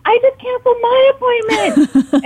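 high, near-crying mock disbelief; imitating teary amazement. Friend who also canceled her appointment, high teary amazement. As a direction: retell her friend's tearful surprise. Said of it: "And she said".

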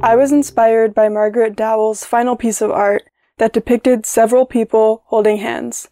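ordinary talk, nothing marked

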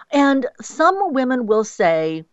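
normal talking voice

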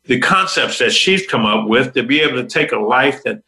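normal talking voice

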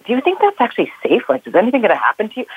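high, anxious questioning voice; worried friend. Retold or imitated. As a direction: ask it like a worried American friend. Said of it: "they're always like".

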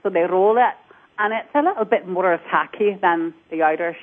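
tacky, hard-hitting Scottish demo; Scottish accent. Shown as a demonstration. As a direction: roll the R's hard, Scottish style. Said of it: "they're gonna roll their R's".

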